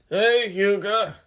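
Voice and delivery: put-on slow, drawn-out greeting from an old shopkeeper; lightly voiced pudgy old man calling out; shop owner greeting. A narrator's reading. Pudgy old shop owner greets his regular customer, drawn out.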